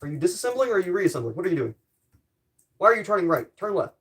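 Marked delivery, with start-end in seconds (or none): none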